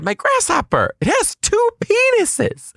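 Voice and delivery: put-on very high, squeaky child proclamation; imitated child insisting at extreme high pitch; kid repeating himself. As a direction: repeat it in piercing falsetto, stretching the end. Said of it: "to ask him a second time".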